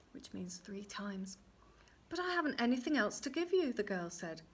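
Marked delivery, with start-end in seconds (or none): 0.1-1.4 soft unvoiced aside; whispered aside. A narrator's reading. Narrator's quiet aside. 2.1-3.7 high fast pleading; pleading girl. Acted.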